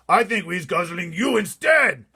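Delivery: slow, loud, high shouted threat; giant bellowing. A narrator's reading. Giant threatening to eat the BFG, bellowing. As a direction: roar every word at him. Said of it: "the flesh-lip eater bellowed".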